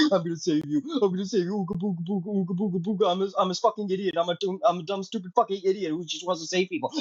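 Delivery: a hammed-up idiot hero, odd strange timbre; mock the hero as a brainless caveman; dumb Superman mockery. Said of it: "He's just there and like".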